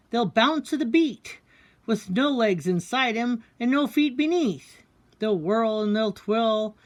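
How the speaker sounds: bouncy announcer-style poem read. A narrator's reading. Announcing the dancing pants to an audience, showman poem read. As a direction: introduce the act like a showman.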